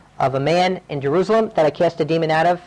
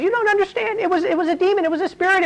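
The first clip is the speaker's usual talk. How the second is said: much higher, urgent pleading voice; 13 semitones higher than usual. Retold or imitated. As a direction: plead desperately to be believed. Man pleading with hostel owner.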